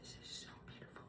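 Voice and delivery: breathy hushed awe, barely voiced; awed whisper. Real, not acted. Unboxing a gift scarf, a stunned whisper. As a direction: breathe it out in wonder.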